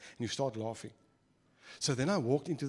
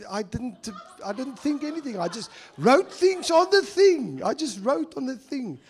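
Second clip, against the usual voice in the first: much higher and louder, pleading innocence; excited protest; 10 semitones higher, 8 dB louder than usual. Real, not acted. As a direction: squeal it out in disbelief. Excited defence of his writing.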